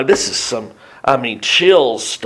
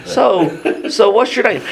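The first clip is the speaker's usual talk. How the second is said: higher, breezy small-talk question; casual, chatty; 6 semitones higher than usual. Retold or imitated. Jesus casually greeting the possessed man, breezy and high. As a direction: ask it like a stranger at a farmer's market. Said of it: "like being at the farmer's market".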